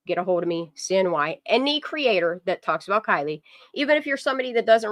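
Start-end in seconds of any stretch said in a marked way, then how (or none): none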